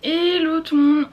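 high, loud cheerful hello; bright greeting. Real, not acted. Vlog greeting.

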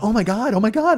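high, loud mock gasping girls; shocked girls. Girls in the back seat gasping at their friend. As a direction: squeal it like shocked young women.

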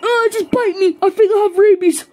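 high, loud frantic exclamations; hammed-up frenzy. Owner of a dead mouse seeing it come alive, frenzied. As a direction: shriek with manic, acted alarm.

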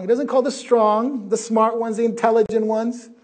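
loud, high preaching proclamation; full-force preaching. Acted. Preacher proclaiming loudly to the congregation. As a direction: proclaim it to the whole hall.

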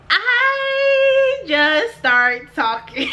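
high, very drawn-out words; laughing disbelief. Real, not acted. Confessing she started rambling onstage, high and drawn out. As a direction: stretch it in a high singsong. Nervous babbling begins, told with laughter.

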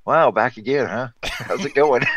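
amused, hammed-up welcome-back; mock-cheery greeting. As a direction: tease loudly, as the boss did. Retold commanding officer's amused greeting.

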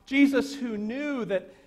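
ordinary talk, nothing marked

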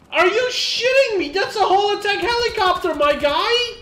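high, loud and disbelieving; outraged rant. Real, not acted. Ranting to a teammate about the wasted helicopter, outraged. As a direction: yell it in total disbelief.

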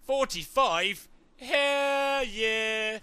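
high, loud victory cheer; thrilled whoop. Real, not acted. Gamer cheering at forty-five wing sticks, thrilled whoop. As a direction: shout it out in delight.

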